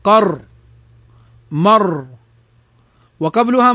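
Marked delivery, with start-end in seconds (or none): 0.0-2.0 قر and مر cut off on a thick r; still heavy ra. Shown as a demonstration. Teacher isolates قر and مر, heavy still ra. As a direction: isolate the syllables قر and مر, ending on r. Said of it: "فهنا الراء ساكنة".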